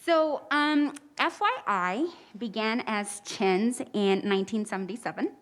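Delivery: speaking through a face mask; muffled voice behind a mask. Real, not acted. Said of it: "I'm muffled with the, uh, mask".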